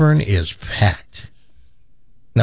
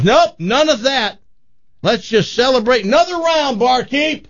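high-pitched, hearty calling out; rallying call; 11 semitones higher than usual. Acted. Dad to crying buddies and barkeep, high rallying call. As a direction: cut off the tears and call for drinks.

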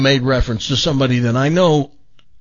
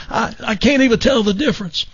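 put-on higher raspy voice with a little southern Missouri accent; Jim McMillan impression; 10 semitones higher than usual. Jim praising [name]'s impression, in raspy southern voice. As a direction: chuckle through it like a friendly garden-show host. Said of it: "I do an absolute perfect impression of you".

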